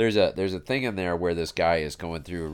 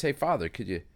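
higher-pitched call for help, lightly acted; mock yell up from a hole; 10 semitones higher than usual. Man in hole calls up to passing priest, mock yell. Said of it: "he yells up at the priest".

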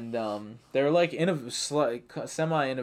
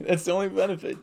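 laughing through the words; 6 semitones higher than usual. Real, not acted. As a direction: say it while laughing. Laughing repeat of line.

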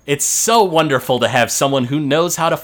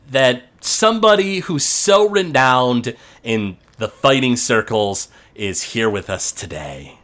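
ordinary talk, nothing marked

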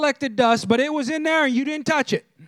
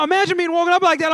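normal talking voice

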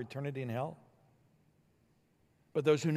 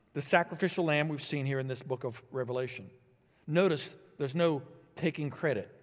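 ordinary delivery

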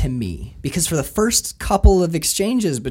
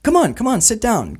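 higher-pitched mock coaxing; Woody; 7 semitones higher than usual. Imitating Woody getting Sean to sit, lightly coaxing. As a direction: coax him, lightly acted. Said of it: "Woody is like".

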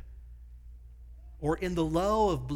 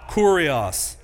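call and response; 3 semitones higher, 9 dB louder than usual. Shown as a demonstration.

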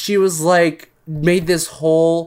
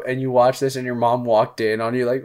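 normal talking voice

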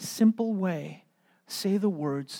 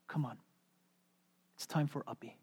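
soft coaxing; 2 semitones lower, 11 dB quieter than usual. Retold or imitated. Gentle coaxing.

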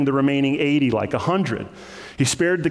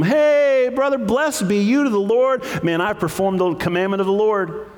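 hearty greeting; 8 semitones higher than usual. Acted. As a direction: hail a brother with overdone cheer. Acting Saul's breezy greeting to Samuel.

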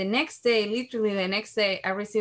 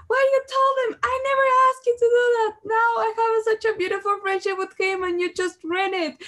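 much higher and louder, furious crying shout; angry tearful yell; 6 dB louder than usual. Retold or imitated. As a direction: scream at him, voice breaking into tears. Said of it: "I was so crying, upset".

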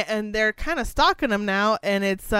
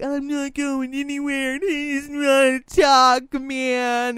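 put-on high, stubborn drunk whine; cling on and refuse, voiced higher; mock drunk girl refusing to leave; 6 semitones higher, 4 dB louder, slower than usual. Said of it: "she's like".

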